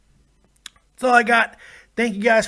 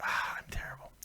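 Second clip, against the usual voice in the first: whispered self-scolding; whispering; 16 dB quieter than usual. Real, not acted. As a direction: breathe it out in a whisper. Muttering self-reproach.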